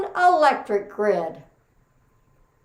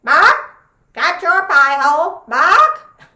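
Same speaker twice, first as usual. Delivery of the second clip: high, loud squawking parrot voice; 6 semitones higher, 9 dB louder than usual. Acted. Parrot mimicking the family, squawked loud and high. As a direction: screech it like a parrot. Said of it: "he even had a parrot that would say".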